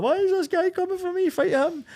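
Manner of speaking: high squeaky indignant voice; mock whiny voice. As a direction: whine it up high like a sulking mate. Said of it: "he's like".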